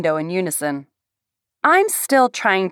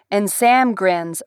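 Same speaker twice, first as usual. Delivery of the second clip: plain reading voice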